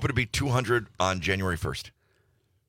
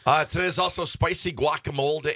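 ordinary talk, nothing marked

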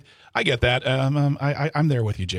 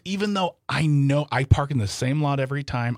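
ordinary talk

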